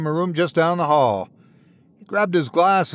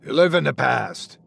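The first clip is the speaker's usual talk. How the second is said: growled. Acted. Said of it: "the voice growled".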